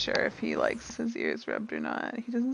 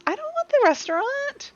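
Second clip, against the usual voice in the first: much higher, small voice protest; timid protest; 14 semitones higher, 4 dB louder than usual. Acted. Ari refusing the wager, timid and high. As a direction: shrink back from the bet.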